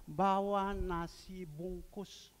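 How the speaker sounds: slow, deliberate instruction. Retold or imitated. Preacher telling the man what to bring, slow deliberate instruction. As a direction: spell out the simple request very slowly.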